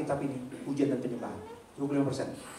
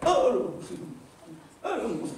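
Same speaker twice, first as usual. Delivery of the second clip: mock shocked groan; 6 semitones higher, 5 dB louder, much slower than usual. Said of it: "Pas kita buka".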